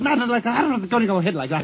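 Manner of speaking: fast, garbled mock record voice; a playful imitation of a voice racing out of control; imitating a sped-up record. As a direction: rattle it off like a record spun too fast. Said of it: "Then he became madder and madder".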